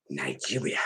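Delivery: very low, soft, drawn for effect; hushed dramatic reveal. Acted. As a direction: drop it way down like a secret punchline.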